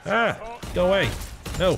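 sudden scared shout; startled yell. Real, not acted. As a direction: yelp and shove it away. Jump scare in game.

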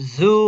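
জু with a buzzing z; Bangla-like z sound. Shown as a demonstration. Teacher showing the letter to pupils, buzzing z. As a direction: sound জু out as a voiced, buzzed z. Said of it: "জয়ের মতন অনেকটা".

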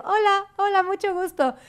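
high, sweet fake-polite hello; sugary fake greeting. (saludo falso agudo y dulce)

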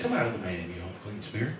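timid, soft come out; meek, weak command. Shown as a demonstration. Preacher mocking a weak rebuke, timid and soft. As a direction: say it like a limp, unconvinced plea. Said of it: "I don't believe he said".

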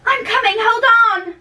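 loud, high call offstage; calling back. Acted. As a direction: shout it back impatiently. Answering the nurse.

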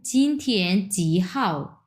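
চিনথিয়েন জি হাও spelled out slowly; slow Mandarin model sentence; Mandarin read slowly. Shown as a demonstration. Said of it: "তারপরে কি বলছে দেখেন".

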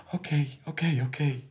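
high, hurried-sounding concession; agitated giving-in. Acted. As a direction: cut the argument off by yielding. Father giving in under pressure. (geagiteerd toegeven)

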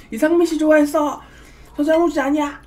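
giggling through the words; laughing. Real, not acted. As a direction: say it while laughing. Laughing confession, then restated.

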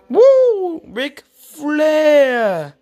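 high, loud mock wrestling woo; excited kid shouting the wrestler's name; Flair woo. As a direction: belt out the woo like a wrestling fan. Presents Ric Flair figure.

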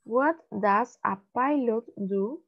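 slow, careful model read, rising at the end. Shown as a demonstration. Teacher reading slowly for students to repeat. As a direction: read it slowly so they can copy.

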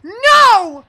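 high, loud cry of no; real alarmed shout; panicked shout. Real, not acted. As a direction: yell as you get killed. Dying in the game.

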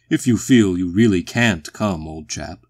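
loud put-on voice, dropping at the end; booming walrus. A narrator's reading. The walrus to Phil, who can't come, booming. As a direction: let him off with pompous boom.

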